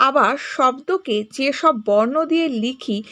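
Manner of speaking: ordinary delivery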